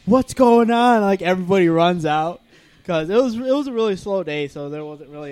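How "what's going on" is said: alarmed question. Retold or imitated. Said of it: "coworkers reacting to the commotion".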